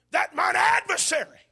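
sudden high, loud declaration; changed, ringing timbre. Acted. Preacher as Job turning on his adversary, changed ringing timbre. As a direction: burst out of the hush with a challenge. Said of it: "the timbre of his voice changes".

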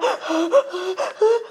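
un jadeo agudo de niña que llora; sollozo de niña imitado. Retold or imitated. Niña llorando por su muñeca. (sollozo de niña, agudo y entrecortado)

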